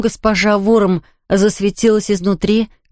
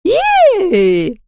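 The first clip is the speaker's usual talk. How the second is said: very high, piercing ghost shriek; ghostly shriek; 13 semitones higher, 3 dB louder than usual. A narrator's reading. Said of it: "завизжала кровавая баронесса".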